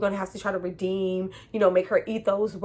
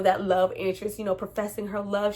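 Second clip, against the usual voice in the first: normal talking voice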